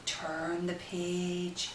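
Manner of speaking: ordinary delivery, nothing marked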